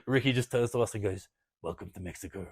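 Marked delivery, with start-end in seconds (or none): 1.6-2.5 low, quiet, offhand delivery; understated punchline. Retold or imitated. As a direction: imitate an unfazed friend, low and soft. Ricky's calm punchline after the shootings.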